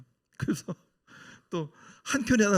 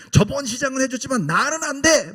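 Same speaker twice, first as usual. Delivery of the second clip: much higher and louder flat refusal; firm shout; 10 semitones higher, 5 dB louder than usual. Retold or imitated. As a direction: slam the door on him, loudly. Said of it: "막 이러고 막 싸우는 거예요". (단호하게 크게 외치듯이)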